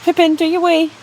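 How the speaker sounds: high, loud pet voice; coaxing the puppy. Real, not acted. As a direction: urge the dog on brightly.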